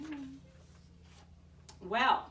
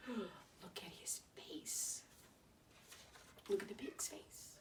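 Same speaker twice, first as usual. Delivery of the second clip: hushed, slow whisper; 13 dB quieter, slower than usual. Real, not acted. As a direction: breathe it softly in suspense. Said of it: "gasps, whispering aside".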